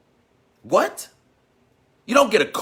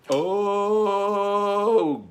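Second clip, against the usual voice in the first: long, high held "ohhh"; exasperated "oh"; 7 semitones higher than usual. Real, not acted. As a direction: wail one drawn-out oh. Exasperated cry after the objection.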